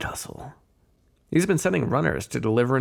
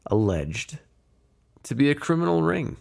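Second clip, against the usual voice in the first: normal talking voice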